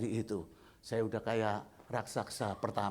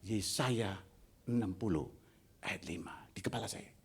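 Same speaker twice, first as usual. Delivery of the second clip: whisper; 6 semitones lower, 6 dB quieter than usual. Retold or imitated. Verse whispered in his head.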